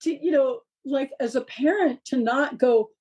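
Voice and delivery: usual speaking voice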